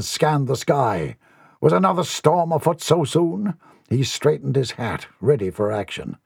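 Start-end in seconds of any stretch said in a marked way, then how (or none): none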